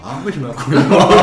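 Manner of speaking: stunned echo. Retold or imitated. Traveller echoes the officer's question, caught off guard and stunned. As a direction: repeat "为什么要雇佣我" back in stunned disbelief. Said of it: "我一听愣了".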